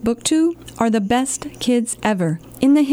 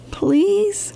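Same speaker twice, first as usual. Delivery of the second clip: high whispered please; 10 semitones higher than usual. Acted. Librarian begs the kids, high whispered please. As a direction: beg in a whisper. Final plea.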